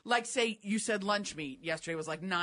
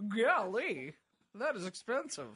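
imitating correspondent's golly. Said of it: "says it just like that".

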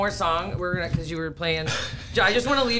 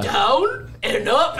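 put-on Arnold accent; 6 semitones higher, much slower than usual. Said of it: "he goes".

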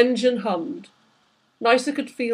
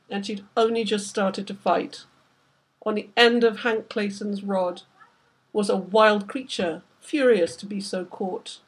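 usual speaking voice

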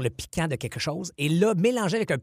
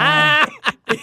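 loud laugh; 11 semitones higher, 10 dB louder than usual. Real, not acted. Big laugh at own story.

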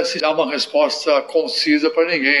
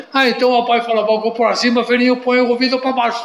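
put-on higher, fast childish pleading; whiny little boy; 6 semitones higher, faster than usual. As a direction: talk like a stubborn toddler, quick and high. Child role in sketch.

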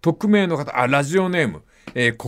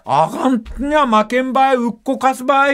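higher, rhythmic Kumamoto fighting talk with a laugh; fierce Kumamoto dialect; 8 semitones higher than usual. Retold or imitated. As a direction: bluster a challenge in Kumamoto dialect. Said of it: "熊本弁は特にリズムあって".

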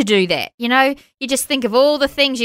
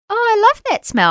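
put-on child; 9 semitones higher than usual. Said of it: "my little one was like".

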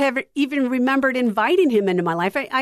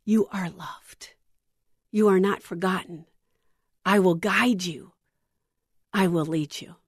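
soft whisper; 3 semitones lower, 3 dB quieter than usual. Acted. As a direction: whisper it close in the ear. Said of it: "whispers in your ear".

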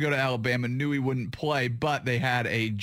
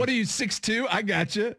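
higher, taunting and cocky; cocky player voice; 7 semitones higher than usual. Retold or imitated. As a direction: brush off a defender.

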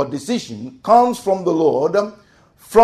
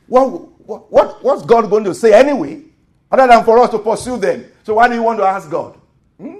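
mock impatience; 7 semitones higher, 6 dB louder, faster than usual. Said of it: "that's the way I would think".

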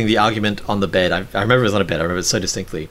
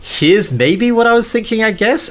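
tentative concession; 11 semitones higher, 4 dB louder than usual. Retold or imitated. Acting out partner conceding in argument.

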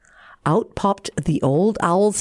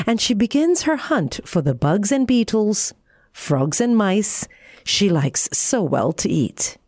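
ordinary delivery, nothing marked

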